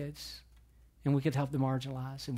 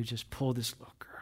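whispered; 5 semitones lower, 7 dB quieter than usual. Real, not acted. Tender retelling of the mother.

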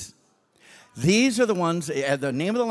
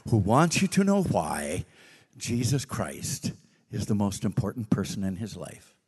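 lower, measured preaching tone; sermon recording voice; 6 semitones lower than usual. Retold or imitated. Preacher recording a sermon for future passengers, low and measured. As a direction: deliver it like a recorded message.

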